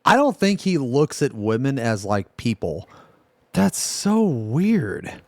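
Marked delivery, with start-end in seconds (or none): 3.5-5.2 high, drawn-out disbelief; real incredulous reaction, slow and high; weirded out. Real, not acted. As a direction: stretch it out, pitched well up.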